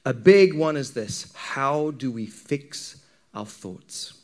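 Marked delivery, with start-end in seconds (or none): none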